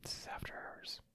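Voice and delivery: barely audible, voiceless whisper; very soft playful hush; mock ASMR whisper. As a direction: whisper it like late-night radio. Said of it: "A S M R".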